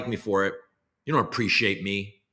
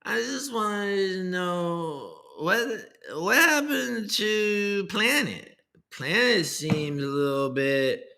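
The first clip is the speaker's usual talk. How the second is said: high put-on coin dev voice; 4 semitones higher, slower than usual. Streamer parodying a crypto dev's new year message, high and slow. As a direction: ham up the goofy developer persona, stretched out. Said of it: "spouting off in that voice".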